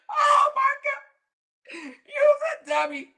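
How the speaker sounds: very high, squeaky laughing outburst; squealing laugh. Real, not acted. Cracking up at Donna's mistake, very high and squeaky. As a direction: shriek it through laughter.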